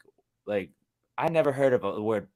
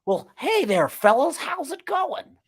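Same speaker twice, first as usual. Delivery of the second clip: much higher, slow hammed-up Southern American drawl; mock American accent; 5 dB louder, slower than usual. Englishman imitating Americans, high slow mock drawl. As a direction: ham up a folksy American greeting. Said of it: "when you guys do".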